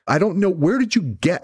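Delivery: normal talking voice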